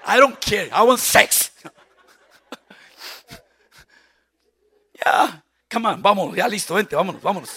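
loud mock pushy husband. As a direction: hurry her along. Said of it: "El hombre quiere sexo".

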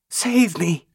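ordinary delivery, nothing marked

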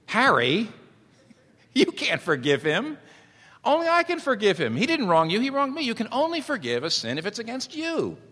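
high, loud, outraged cry of a wronged man; indignant protest. Pretend. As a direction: shout it as the one who was hit.

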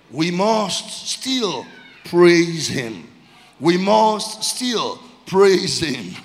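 very slow, each word held long; slow exhortation. Real, not acted. Preacher urging church to still praise God, slow weighty exhortation. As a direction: spell it out loudly, word by word.

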